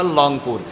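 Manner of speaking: লংপুর, initial R of Rangpur turned to L; Rangpur mispronunciation. Retold or imitated. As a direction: quote a local saying লংপুর with L for R. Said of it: "রংপুরকে বাড়ি".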